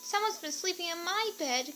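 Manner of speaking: high little cub voice; Baby Bear crying out. A narrator's reading. Baby Bear finding his bed used, high and small. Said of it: "cried Baby Bear".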